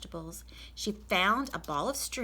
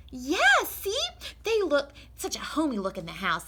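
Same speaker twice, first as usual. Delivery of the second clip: much higher, eager to please, getting louder; Amelia Bedelia; 7 semitones higher, 6 dB louder than usual. Acted. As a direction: earnestly promise the master. Amelia proudly shows.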